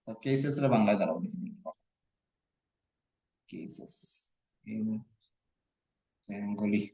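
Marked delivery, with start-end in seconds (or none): 3.5-6.9 trailing off in a soft hesitant voice; quiet, unsure. Real, not acted. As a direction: mumble while searching for the word. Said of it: "এই জায়গাতে আমিও পারিয়াম না".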